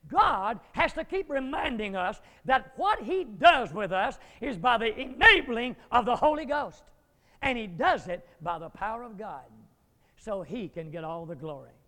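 usual speaking voice

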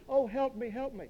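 high, soft imitation of a drowning girl; mock scream for help. Preacher voices a drowning girl calling up to the church, mock scream. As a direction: plead up high without real volume. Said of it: "she's screaming up to the church".

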